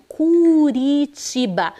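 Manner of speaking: "Curitiba" devagar e mais agudo, R de um toque; R fraquinho. Shown as a demonstration. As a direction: alongue "Curitiba" com o R leve. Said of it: "é fraquinha". (erre fraquinho em "Curitiba")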